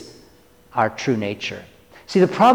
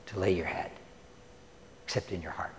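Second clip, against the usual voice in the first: soft and tender; 8 semitones lower, 18 dB quieter, faster than usual. Acted. Quoting Jesus, fading tender.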